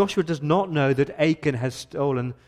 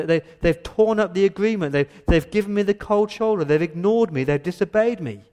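raised, insistent voice of God; stern rebuke; 5 semitones higher than usual. Retold or imitated. God rebuking Joshua over Israel's sin, raised and stern. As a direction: scold a people who broke faith.

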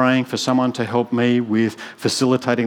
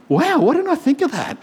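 much higher, bright with surprise; amazed realisation. Retold or imitated. Pastor recalls realising in prayer, amazed and much higher. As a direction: gasp it out as the penny drops.

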